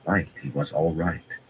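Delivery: ordinary delivery, nothing marked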